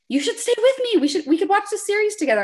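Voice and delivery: high, fast, mock eager invite; gushing to win a friend over; lightly hammed-up excited friend; eager pleading. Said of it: "And you're like".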